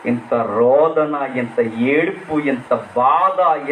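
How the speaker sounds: slow lament. Retold or imitated. Lamenting David's grief.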